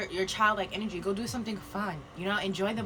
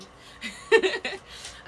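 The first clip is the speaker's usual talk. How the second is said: breathy giggle; 10 semitones higher, 4 dB louder than usual. Real, not acted. Giggling at own joke.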